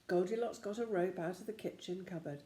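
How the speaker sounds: usual speaking voice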